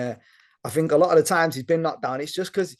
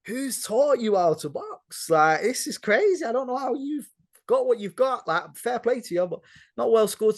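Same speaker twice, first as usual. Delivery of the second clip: incredulous; 8 semitones higher than usual. Retold or imitated. Boxing guest mocking Joshua's defence, incredulous. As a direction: talk to him as if baffled he ever won.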